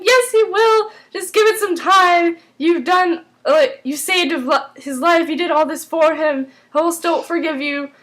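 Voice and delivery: mock-earnest persuading, starting high; insistent reassurance, imitated. Voices a character insisting and reassuring.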